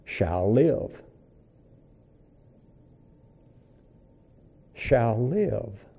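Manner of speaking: softly echoed scripture; hushed. Acted. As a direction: let the words settle quietly.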